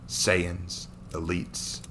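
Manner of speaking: ordinary delivery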